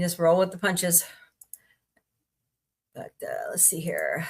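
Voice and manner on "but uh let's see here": whispered aside to herself. Real, not acted. Streamer whispering to herself while looking something up. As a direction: breathe it under your breath while searching.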